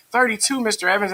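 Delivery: high-pitched pupil answer; student voice. Acted. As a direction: play a kid answering teacher. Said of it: "They say".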